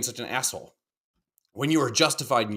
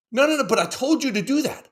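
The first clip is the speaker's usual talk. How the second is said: higher, insistent dad repeating himself; exasperated dad, pitch up; 12 semitones higher, 3 dB louder than usual. Retold or imitated. Dad repeating himself to his kids, higher and insistent. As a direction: push the point louder and higher.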